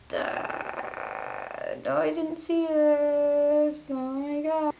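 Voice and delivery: exaggerated "oh my God" reaction; mock shock. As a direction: play up the horror of not knowing. Acting out her own shocked reaction.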